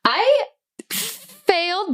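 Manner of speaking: high, stretched cry of dismay; dismayed wail. Real, not acted. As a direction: let it out like a groan. Player sees failed roll.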